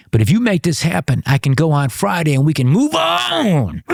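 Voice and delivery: hammed-up eagerness, nearly shouting the offer; eager promise. [name] eagerly accepting the offer.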